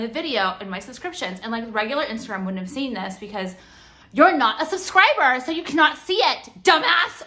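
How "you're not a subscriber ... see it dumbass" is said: loud, raised, emphatic put-down; higher, punched-out insult; angry scolding. Real, not acted. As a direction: scold with open contempt. Scolding non-subscribers in a rant.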